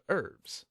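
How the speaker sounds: "herbs" with the H dropped, as "erbs"; American silent H. Shown as a demonstration. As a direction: say "herbs" starting straight on the vowel. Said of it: "instead of herbs".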